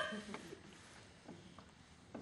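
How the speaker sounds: breathy gleeful laugh; soft wheezy laugh. Acted. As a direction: laugh after crowing, trailing away. Laughs at own triumph.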